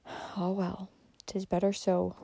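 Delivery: soft, resigned murmur. A narrator's reading. Old lady murmuring over the dying girl, soft and resigned. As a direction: let it fall away, sorrowful. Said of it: "murmured the old lady".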